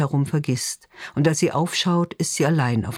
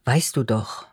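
a touch of annoyance, dropping lower; mild, irritated brush-off to her father; annoyed; 3 semitones lower than usual. Acted. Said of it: "Ihre Stimme klingt genervt".

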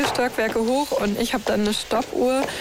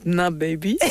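cool; 4 semitones lower than usual. Retold or imitated. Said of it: "lehnt er so ganz cool an und sagt".

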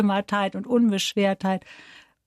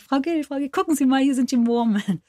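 imitated eager fan, pitched higher; begeistertes Nachahmen; 6 semitones higher than usual. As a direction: call out like an excited fan showing something. Spielt begeisterte Zuschauer nach. (begeistert nachgeahmter Zuruf)

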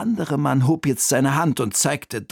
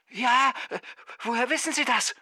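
very high, thin distant voice; startled phone caller; 11 semitones higher, 4 dB quieter than usual. A narrator's reading. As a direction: stammer in surprise down the phone. (verdutzte, hohe Telefonstimme)

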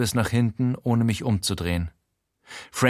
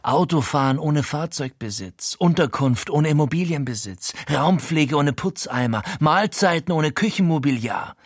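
higher, pressing list of buzzwords; ranting pitch; 5 semitones higher than usual. A narrator's reading. Frankie ranting about platform business, pressing. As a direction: rattle it off like a fanatic.

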